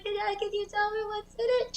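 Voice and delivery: put-on high, pleading child; whiny kid voice. Kid begging mom to let him unwrap the present first. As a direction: beg like a panicked eight-year-old.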